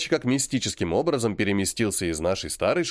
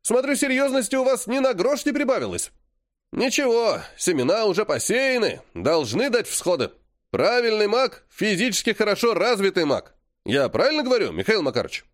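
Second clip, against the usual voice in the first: barking gym coach; 9 semitones higher than usual. A narrator's reading. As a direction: bark it like a gym coach lecturing students. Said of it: "рявкнул физрук".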